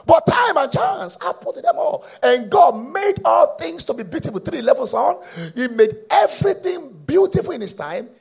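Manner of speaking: high, loud preaching cry; full-force preaching. Acted. Preacher proclaiming the verse loudly to the church. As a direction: lift the verse up and shout it.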